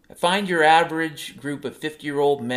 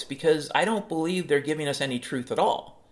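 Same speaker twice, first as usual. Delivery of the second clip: normal talking voice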